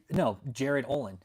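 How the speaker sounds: ordinary talk, nothing marked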